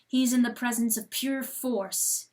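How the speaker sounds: ordinary delivery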